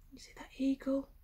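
put-on quick hushed whisper to the kids; rushed storytime whisper; fast whisper. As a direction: whisper it fast like sharing a secret. Whispered aside to listening children.

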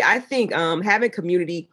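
usual speaking voice

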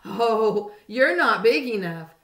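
loud, scornful brush-off; grouchy dismissive. A narrator's reading. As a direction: wave them off loudly. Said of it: "said the ladybug".

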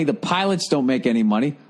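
normal talking voice

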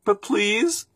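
put-on high, pleading child's voice; small hungry boy. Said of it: "said the boy".

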